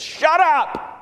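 high, forceful yell; blunt, full-voiced shouting, drawn out at the end; commanding shout. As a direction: silence a storm with a shouted order. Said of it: "telling the storm to be muzzled".

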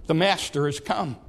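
ordinary delivery

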